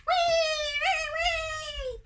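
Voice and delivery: very high, loud squealed zaps; piercing lightning whistle with the voice; long high-pitched wee; lightning noise. A narrator's reading. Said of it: "at once he flashed his lightning".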